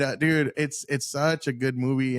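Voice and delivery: usual speaking voice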